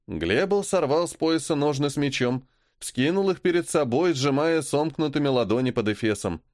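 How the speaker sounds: plain reading voice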